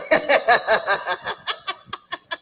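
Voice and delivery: real laugh, drawn out. Real, not acted. Pastor laughing at his own church story with the congregation. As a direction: let a long laugh roll out.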